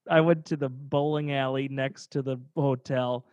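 ordinary talk, nothing marked

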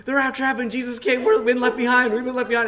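high, loud, frantic mock panic; retold freak-out. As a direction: blurt it out as if freaking out. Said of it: "and I'm freaking out".

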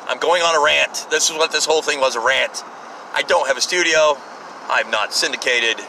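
ordinary talk

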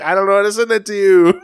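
loud, high wail through laughter; comically helpless shouted reply; exasperated cry. Retold or imitated. Replying to streamer wanting playlist, comic exasperation.

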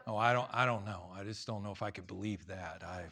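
low, soft hesitant voice; mock hesitant objection; doubtful mumbling. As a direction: imitate a reluctant doubter, quietly. Doubting listener voiced by the preacher.

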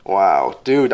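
ordinary talk, nothing marked

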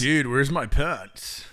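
slow low stoner voice; stoner dude. Pretend. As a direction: drag it out, dazed. Movie quote bit.

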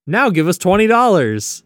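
high, loud pitchman's demand; mock sales demand. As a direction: hit them with a hammed-up hard sell.

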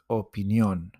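opinión, stress on the final «ón». Shown as a demonstration. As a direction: stress the last syllable of «opinión». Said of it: "It's always on the top of the vowel".

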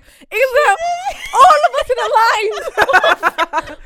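high, loud, laughing question; eager girl asking mum; excited question. Retold or imitated. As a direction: ask breathlessly thrilled.

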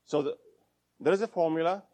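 normal talking voice

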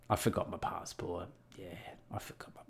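trailing into a whisper; low, under-the-breath admission; hushed confession. Retold or imitated. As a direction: confide it, fading to a whisper. Confessing the problem at the desk.

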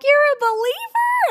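excited question. Retold or imitated. Bethany thrilled to learn the nurse is a believer, high squeal. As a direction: squeal it out in delight. Excited discovery of shared faith.

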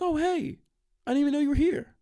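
put-on high, airy oblivious girl; mock girl voice. Birthday girl greeting him as he leaves. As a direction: chirp it like a clueless host.

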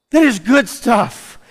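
rousing emphatic repeat. Shown as a demonstration. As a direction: say it again and lift it to the church.

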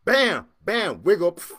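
loud, high "bam" impacts; imitated slamming. Storyteller replaying the final blows on the safe, loud slams. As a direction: hit each bam hard and let it drop.

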